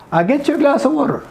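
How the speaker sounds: high American demo with "get-chu"; American accent. Shown as a demonstration. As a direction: run it together like an American. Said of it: "American accent". (ऊँचे सुर में American लहजा)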